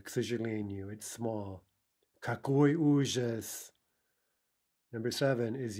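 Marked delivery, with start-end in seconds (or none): 2.2-3.7 slow, theatrical dismay; hammed-up horror, drawn out; mock horror. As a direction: play the tragedy slowly.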